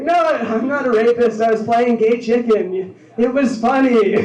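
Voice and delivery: loud, high, indignant protest; mock defensive plea. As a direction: shout your innocence to the cops.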